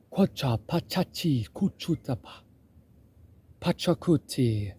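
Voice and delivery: softly attempted Quechua-speaker accent; imitated Inca accent. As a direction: try out a hushed Inca accent, syllable by syllable. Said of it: "interviews of Inca people".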